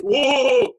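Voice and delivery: loud, drawn-out "whoa"; hammed-up excited whoa; mock whoop. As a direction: whoop it out, playfully over the top. Re-enacted reaction in rehearsal.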